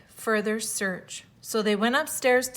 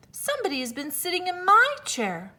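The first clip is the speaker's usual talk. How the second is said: higher mama bear; middle-sized bear voice; 8 semitones higher, faster than usual. A narrator's reading. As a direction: lift the pitch for the mother bear. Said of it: "in her middle voice".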